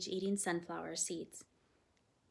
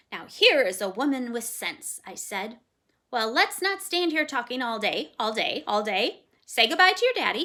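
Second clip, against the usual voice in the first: loud, repeating parrot chatter; 6 semitones higher, 11 dB louder than usual. A narrator's reading. Parrot teasing the fearful girl, loud chatter. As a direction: rattle it off like a bossy parrot.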